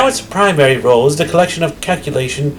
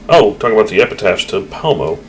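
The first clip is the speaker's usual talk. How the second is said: usual speaking voice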